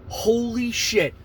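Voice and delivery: high, drawn-out amazement; amazed. Retold or imitated. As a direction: stretch it out, loud and stunned. His amazed reaction, retold.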